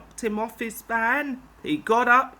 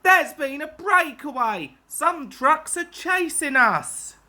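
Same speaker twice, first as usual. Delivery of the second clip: alarmed; 7 semitones higher, 3 dB louder than usual. Acted. Driver alarmed on runaway.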